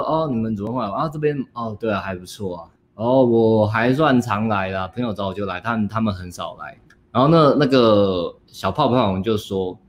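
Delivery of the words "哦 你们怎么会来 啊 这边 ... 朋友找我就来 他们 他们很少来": softer, hesitant and shy, fumbling for small talk; scared mumble. Retold or imitated. Coach nervously answering the girl he fears, quiet and meek. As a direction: shrink back and answer timidly. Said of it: "我真的很惊恐".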